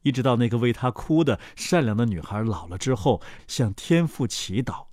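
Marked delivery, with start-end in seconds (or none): none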